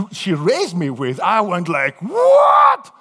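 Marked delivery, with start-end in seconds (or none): 2.0-2.9 very high, loud outraged what; scandalised shout; shocked shout. Retold or imitated. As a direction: cry out in disbelief. Shocked at the burp.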